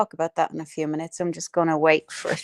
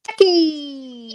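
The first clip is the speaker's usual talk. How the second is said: high, loud call of welcome; 8 semitones higher, 5 dB louder than usual. Real, not acted. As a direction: call out her name happily. Greeting arriving viewer, calling out.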